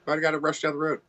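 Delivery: lightly put-on defensive driver; mock self reply. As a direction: play his own innocent answer. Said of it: "I'm like".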